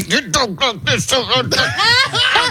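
imitating an angry dad through clenched teeth; slow, high, strangled mock fury; mad, gritted teeth. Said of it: "he would grit his teeth when he was mad".

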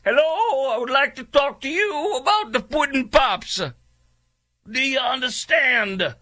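very high, hammed-up impersonation voice; bad Bill Cosby. Host doing a horrible celebrity impersonation for a laugh, exaggerated caricature. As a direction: push it way up into a silly, stretched-out caricature. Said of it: "This is my horrible impersonation".

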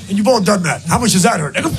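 normal talking voice

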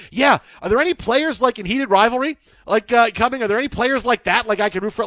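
book club women. Retold or imitated. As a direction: mimic a keen newcomer asking about players. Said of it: "like the women in my wife's book club".

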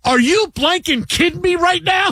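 high, outraged mock shout at the husband; furious wife. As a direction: yell it like she can't believe him. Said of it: "she goes".

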